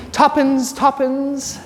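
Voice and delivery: a hint of the bird woman calling; light Mary Poppins nod; Mary Poppins quote. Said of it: "You know, Mary Poppins".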